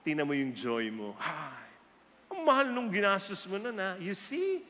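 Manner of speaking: usual speaking voice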